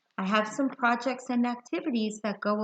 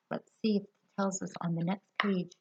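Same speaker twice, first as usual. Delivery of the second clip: ordinary delivery, nothing marked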